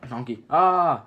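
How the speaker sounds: loud sudden cry of fright; startled scream. Acted. Donkey sees the ogre Fiona and screams loudly in fright. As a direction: yelp as if something jumped out at you.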